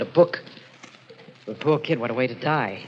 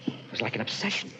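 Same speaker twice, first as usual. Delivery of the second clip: very quiet, low, almost whispered; hushed dread; 5 semitones lower, 7 dB quieter than usual. Acted. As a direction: confess it in a haunted hush. Troubled, hushed confession.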